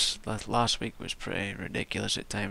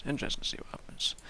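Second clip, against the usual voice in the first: quick, breathy whispered repeat; 4 dB quieter, faster than usual. Shown as a demonstration. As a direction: whisper it fast to yourself.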